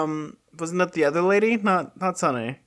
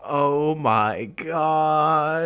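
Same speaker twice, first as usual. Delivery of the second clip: stunned; much slower than usual. Real, not acted. Still shocked after gasp.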